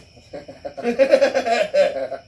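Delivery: loud real laugh; loud laughter. Real, not acted. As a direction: burst out laughing. Laughing at own joke.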